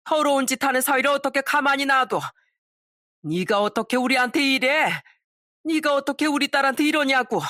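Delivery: mother-in-law exploding at the cheater; enraged mother. Acted. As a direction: scold him with mounting fury. Mother berates son-in-law.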